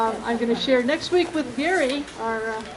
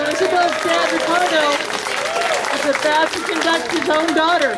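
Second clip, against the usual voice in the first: louder, projected MC announcement; 3 semitones higher, 5 dB louder than usual. Real, not acted. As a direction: announce it big to the whole crowd. Announcing the winner to the crowd.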